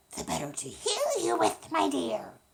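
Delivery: the wolf toying with his prey; sly wolf playing grandma. Wolf answers Little Red.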